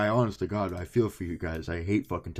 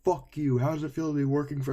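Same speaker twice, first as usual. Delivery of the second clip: higher, quick mocking jab; mock angry woman; 7 semitones higher than usual. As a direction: imitate a rude caller, fast and high. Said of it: "this one chick I remember that was like".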